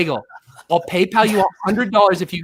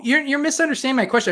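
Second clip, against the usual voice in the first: normal talking voice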